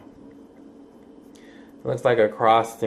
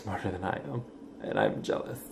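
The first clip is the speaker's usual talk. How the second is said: whispered; 2 semitones lower, 11 dB quieter than usual. Real, not acted. Vlogger admits to smarter viewers he is jealous, whispering. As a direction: confess it under your breath. Jokey confession after apology.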